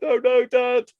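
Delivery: high, loud, put-on tearful teen; whiny daughter voice. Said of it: "She was like".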